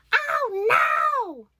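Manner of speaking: very high, loud wail into gibberish; hammed-up terrified scream on a coaster; imitated panicked yelling. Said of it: "starts yelling nonsense gibberish".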